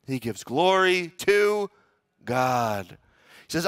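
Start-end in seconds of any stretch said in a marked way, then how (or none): none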